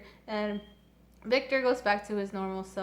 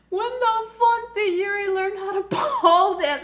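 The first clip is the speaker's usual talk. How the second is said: much higher, louder, laughing as she asks; laughing disbelief; 9 semitones higher, 8 dB louder than usual. Retold or imitated. Fan seeing Yuri pole dance, loud high laughing question. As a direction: crack up mid-question.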